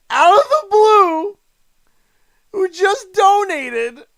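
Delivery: high, loud, breaking with disbelief; shocked laughter. Real, not acted. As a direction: shout it half-laughing in shock. Shocked by big donation.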